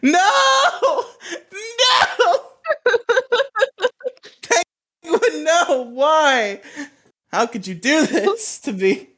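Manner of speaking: very high, wailing cries broken by laughter; soap opera acting. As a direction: ham up the heartbreak like a soap star. Mock grief over movie plot.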